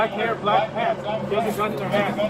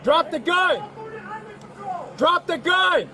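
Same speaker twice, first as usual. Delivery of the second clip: higher, urgent yell; shouted command; 10 semitones higher than usual. Real, not acted. Officer warns suspect.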